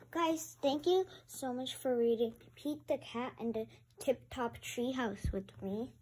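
usual speaking voice

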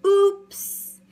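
sudden high, loud exclamation; surprised oops. Acted. Reader reacting to the falling dog, startled cry. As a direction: yelp it as the dog tumbles.

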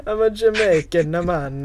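cartoon Italian trying Jamaican; mock Italian Jamaican. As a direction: wannabe Italian Jamaican dude. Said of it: "wannabe Italian Jamaican dude".

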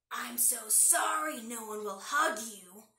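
slow, loud, sly drawl; drawling fox. Acted. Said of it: "drawled Fox".

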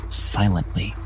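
usual speaking voice

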